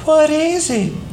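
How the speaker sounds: put-on high, slow, hushed child's question; a little boy stunned by the dead bird; awed little boy. A narrator's reading. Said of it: "Doodle replied".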